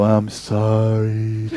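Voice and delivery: loud, long drawn-out mock apology; insincere apology. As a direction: drag out an exaggerated sorry, not meant from the heart. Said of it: "You're not, you're coming from your heart".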